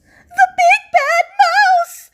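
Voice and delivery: high, loud, slow scream; terrified yell. Acted. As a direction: scream it in terror. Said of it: "yelled the Gruffalo's child".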